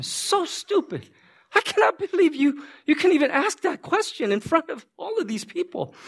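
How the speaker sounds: exasperated, high-pitched berating; mock scolding. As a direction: act out an incredulous public dressing-down. Acted public scolding of a pastor.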